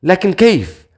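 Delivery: high-pitched bewildered question; stunned. A narrator's reading. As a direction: blurt it in disbelief, pitch leaping up. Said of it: "ثم أكملت بذهول".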